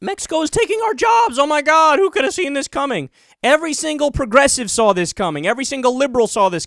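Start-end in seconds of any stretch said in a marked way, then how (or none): 0.0-3.1 high mocking Trump whine; hammed-up fake outrage; mocking Trump complaining about Mexico, high and whiny. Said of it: "he talked about Mexico".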